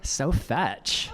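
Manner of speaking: put-on bright Valley Girl drawl on so fetch; teen Valley Girl voice, high and sassy; Valley Girl accent. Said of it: "my best Valley Girl accent".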